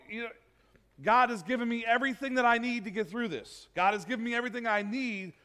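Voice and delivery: usual speaking voice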